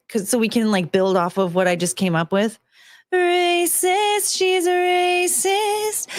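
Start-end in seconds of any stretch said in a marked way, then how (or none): 3.1-6.1 Disney princess. Pretend. Composing the princess song.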